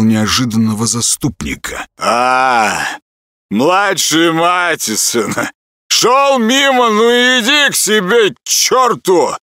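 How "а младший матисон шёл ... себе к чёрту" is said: put-on high, slow, sneering drunk; mock the boy, drunk and rude; drunk fisherman voice. Drunk fisherman mocks Sveri. (пьяный насмешливый рыбак)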